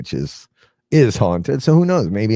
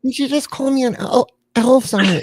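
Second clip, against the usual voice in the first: put-on little girl voice. Puppet Annabelle voice.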